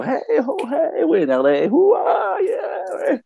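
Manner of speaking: high whooping party chant; party whoops. Retold or imitated. Dancing with his girl, hyped party whoops. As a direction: whoop it up like you're on the dance floor.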